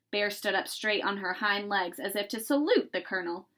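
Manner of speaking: ordinary delivery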